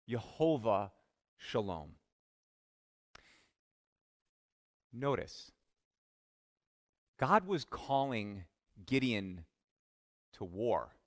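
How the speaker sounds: low and soft, with long pauses between words; solemn hush. Acted. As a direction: let each word hang in the quiet. Solemn naming and point.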